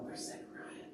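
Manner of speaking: whispered nervous question; hushed wondering. Acted. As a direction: whisper it among the waiting crowd. Waiting people murmur at the temple.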